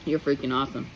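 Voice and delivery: ordinary talk, nothing marked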